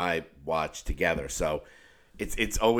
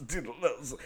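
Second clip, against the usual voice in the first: higher, softer goofy voice; silly put-on voice; 5 semitones higher, 7 dB quieter than usual. Shown as a demonstration. Showing off the new voice to the co-host, silly voice. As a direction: mumble it in the silly voice. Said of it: "So that's my new voice right now".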